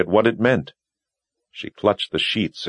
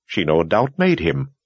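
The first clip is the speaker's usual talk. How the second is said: insistent; 8 semitones higher than usual. A narrator's reading. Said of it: "Kara insisted".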